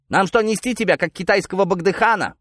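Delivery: high, exasperated sarcastic question; scolding shout. Acted. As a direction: berate a prisoner who won't walk. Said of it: "прикрикнул сержант".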